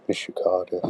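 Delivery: ordinary talk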